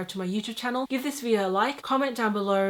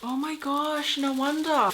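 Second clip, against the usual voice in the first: surprised; 7 semitones higher than usual. Real, not acted. Opening parcel, surprise.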